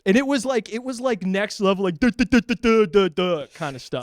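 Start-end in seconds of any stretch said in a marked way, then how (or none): 2.0-3.1 higher, louder string of duhs; imitated rapid-fire noise. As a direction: mimic escalation with punchy repeated syllables. Imitating an escalating back-and-forth.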